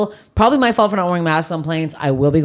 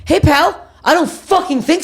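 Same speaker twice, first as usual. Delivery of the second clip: high, slow, confrontational telling-off; mock yell; 12 semitones higher than usual. Retelling yelling at a man cutting the TSA line, high and slow. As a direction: square up to the line cutter. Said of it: "I go".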